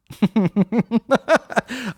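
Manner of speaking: high, loud fake laugh; hammy high laugh. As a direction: laugh at your own joke. Character laugh.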